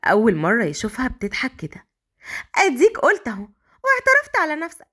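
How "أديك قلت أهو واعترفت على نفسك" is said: high-pitched, amused heroine voice; sweet laughing reply. A narrator's reading. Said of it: "مليكة بضحكة حلوة".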